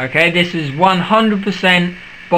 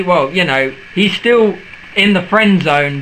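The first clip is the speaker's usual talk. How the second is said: ordinary talk, nothing marked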